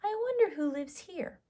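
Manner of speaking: put-on high, light little-girl voice; young Goldilocks wondering aloud. Goldilocks character voice.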